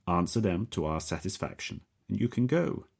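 put-on rising, lightly accented promise; precise Slavic accent. Captor offering the prisoner release, Slavic accent. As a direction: dangle freedom in a careful Slavic accent. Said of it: "pronounced very precisely".

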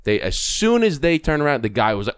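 ordinary talk, nothing marked

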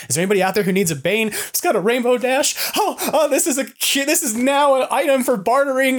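hammed-up bargain hunter pitching; mock eager hawker. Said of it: "I'm like".

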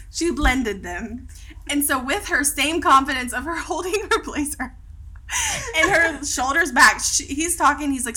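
ordinary talk, nothing marked

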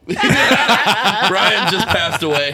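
slow words through laughter; barely getting it out while cracking up; laughing. Real, not acted. Laughing after a roast.

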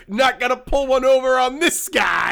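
half-laughing mock bravado; mock tough captain. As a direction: ham up the smug captain through a laugh. Captain's smug line acted out.

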